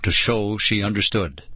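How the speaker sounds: ordinary delivery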